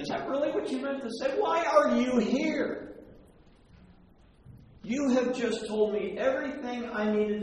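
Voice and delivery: high, loud disbelief at the words; incredulous Jesus. Retold or imitated. Jesus repeating the father's doubt in loud, high disbelief. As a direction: throw his words back at him, astonished. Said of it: "Jesus goes".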